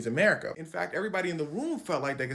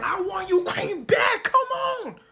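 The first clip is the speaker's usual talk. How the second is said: much higher, loud, slow whining shout; mock Putin tantrum; 9 dB louder, slower than usual. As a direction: wail it like a crying toddler throwing a fit. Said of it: "Putin the whole time in the background crashing out".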